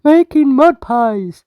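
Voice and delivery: Ted chiming in with his own fun; Ted's voice. Acted. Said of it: "said Ted".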